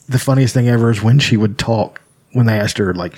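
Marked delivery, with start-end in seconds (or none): none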